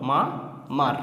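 মা, a clear pause, then মার; teacher's split reading, মা then মার; broken into syllables. Shown as a demonstration. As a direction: sound out মা and মার separately. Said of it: "ভেঙে ভেঙে উচ্চারণ করে পড়তে হবে".

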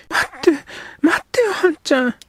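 put-on a girl grabbing his sleeve to stop him; girl pleading. Girl stops him urgently.

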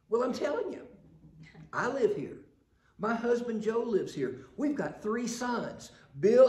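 high, loud lady insisting on her list; insistent lady voice. Retold or imitated. Preacher voicing the lady insisting to the census taker. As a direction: push back, she's telling him already.

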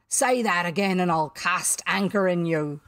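loud, threatening pirate captain; mimicking Hook. A narrator's reading. Boy threatens the real captain, mimicking. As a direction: menace him in the captain's voice. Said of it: "the voice retorted".

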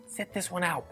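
normal talking voice

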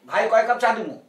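relaxed Bangladeshi dialect voice; Bangladeshi tea-seller dialect. Retold or imitated. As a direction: call out like a tea-stall man in Dhaka dialect. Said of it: "এটা হলো চার দোকানে বসে চাওয়ালা বলছে".

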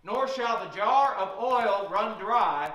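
normal talking voice

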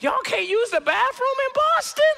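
very high-pitched baffled comeback; exaggerated comic disbelief; incredulous. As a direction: climb into a squeaky, disbelieving question. Acted comeback to the angry fan.

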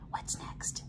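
put-on hushed whisper asking the kids; whispered question. Teacher quizzing the children, soft whisper. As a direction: whisper it like a secret to the class.

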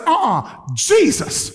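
high, drawn-out warning "Uh-uh, Jesus"; prophetess rebuking. Retold or imitated. Prophetess stops the angry gang member walking out, high slow rebuke. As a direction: call on Jesus slowly, high and sharp, stopping him. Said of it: "She said".

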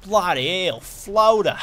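put-on parroting the game character in a clumsy Australian accent; bad Australian accent. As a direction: ham up a poor Aussie copy of the game line. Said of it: "That was a terrible, uh, Australian accent".

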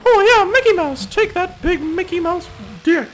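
very high, squeaky cartoon mouse voice, loud at first; high falsetto cartoon mouse; Mickey Mouse voice.

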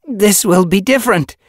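protesting. Acted. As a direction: try to argue back. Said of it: "she tried to protest".

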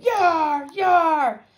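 high, loud drawn-out yars; pirate shout. A narrator's reading. Ghost pirates all together, repeated loud high shouts. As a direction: holler like a pirate crew. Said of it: "the pirates all shouted".

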